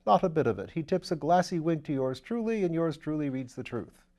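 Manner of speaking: put-on gushing welcome to an old friend; Lord Peter, eager.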